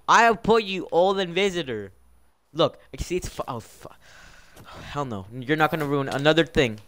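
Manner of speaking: normal talking voice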